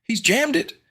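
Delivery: put-on alarmed girl; girl voice aloud. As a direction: blurt it out. Vampy alarmed.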